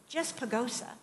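usual speaking voice

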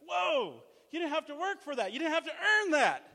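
high, loud incredulous protest; mock jealous outcry. Preacher mocking veterans jealous of the young. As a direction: play the resentful old-timer.

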